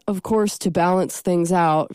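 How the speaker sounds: ordinary talk, nothing marked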